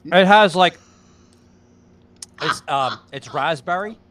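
chewing while talking; talking through a mouthful of bar; mouth full. Real, not acted. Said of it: "talking with his mouth full on air".

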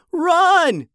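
high, loud, drawn-out yell. A narrator's reading. Shai to his brother as nuggets chase them, yelled. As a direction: yell an urgent warning. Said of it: "and Shai yelled".

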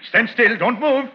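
high, loud panicked command; a man with a gun shouting at a thief to stay put. Acted. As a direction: yell at him to freeze, voice cracking high. Holding a thief at gunpoint.

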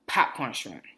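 usual speaking voice

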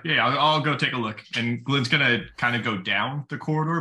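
ordinary talk, nothing marked

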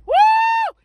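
high, loud "woo"; excited whoop. Real, not acted. Preacher punctuating a point, excited whoop. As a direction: let out a sudden high whoop.

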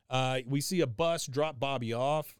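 usual speaking voice

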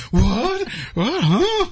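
high, startled confused questions; groggy siblings confused. Retold or imitated. Siblings waking in the car, high startled confusion. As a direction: jolt awake, baffled. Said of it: "when they woke up".

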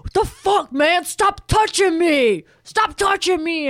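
high, loud panicked yell; shrieking at a drunk on the train; retold scream. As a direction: scream at the stranger to back off. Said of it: "starts screaming at him".

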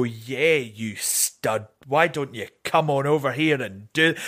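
low, lightly hammed-up seductive come-on; flirty come-on. To a man calling her beautiful, a low mock seduction. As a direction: play along like a flirty drag queen. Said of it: "and you'd go".

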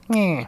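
small high "meh" bleat; little high-pitched creature noise; imitated creature noise. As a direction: mimic a tiny mouth squeaking. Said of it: "And it goes like".

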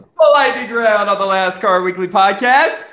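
high, loud hyped game-show announcer. Pretend. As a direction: boom out the segment like a TV host.

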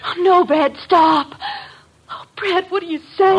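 distressed protest; alarmed plea. Acted. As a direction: cry out to Brad in alarm. Brad falsely confesses to protect her.

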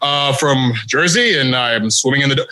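low, unsure, halting mumble; mumbling nervous wrestler. Retold or imitated. Mocking a friend's weak promo, low hesitant mumble. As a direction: fumble the lines like a nervous rookie. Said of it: "you're just like".

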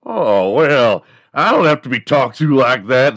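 loud, high, indignant Wild West yokel; offended cowboy. Acted. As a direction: bristle like a hammed-up insulted cowboy. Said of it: "they always respond so fucking funny".

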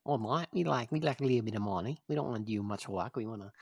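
lightly voiced cheeky Aussie bloke; mock Aussie accent. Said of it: "came in and was like".

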